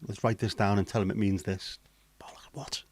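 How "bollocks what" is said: whisper. Real, not acted. A whispered aside.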